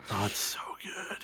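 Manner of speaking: soft breathy whisper. Real, not acted. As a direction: whisper it fondly. Fond aside about the show.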